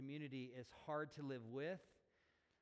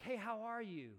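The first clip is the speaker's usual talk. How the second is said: higher, breezy "how are you"; casual greeting; 7 semitones higher, 4 dB louder than usual. Acted. Pastor acting out an everyday greeting, casual and higher. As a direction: toss it off as an everyday hello. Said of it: "answering this question, like".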